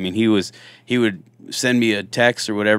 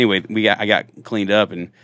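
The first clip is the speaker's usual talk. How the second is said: ordinary talk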